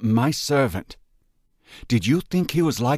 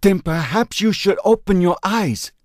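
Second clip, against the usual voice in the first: put-on higher, impassioned voice of the old friend; earnest friend pleading; 10 semitones higher, 3 dB louder than usual. A narrator's reading. Chuan answering Ben's grief with fervent conviction. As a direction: press the truth on him with fervour.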